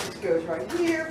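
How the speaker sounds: ordinary talk, nothing marked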